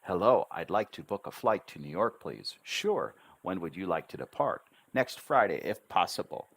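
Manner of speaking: quick demo dialogue with clear syllables; fast but rhythmic. Shown as a demonstration. Teacher demonstrating a flight booking to students, fast with steady rhythm. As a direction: say it briskly, keeping the rhythm. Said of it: "I wouldn't speak any faster than that".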